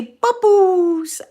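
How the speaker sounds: put-on high, loud wee Scottish toddler voice; imitating toddler grandson. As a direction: squeal it like a cute Scottish tot. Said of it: "it's a wee Scottish accent".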